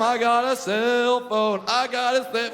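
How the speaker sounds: slow, high, gleeful singsong; gloating mugger. Retold or imitated. Mugger going home with the phone, gloating singsong. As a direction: gloat slowly, drawing out the words. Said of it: "picturing this guy going home".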